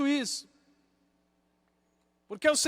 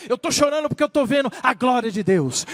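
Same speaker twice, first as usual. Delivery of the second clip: raised, impassioned testimony; fervent testimony; 2 semitones higher, 5 dB louder than usual. Retold or imitated. Believer tells why they weep, fervent and raised. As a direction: proclaim it in full preaching voice. Same answer, now preached with fervour.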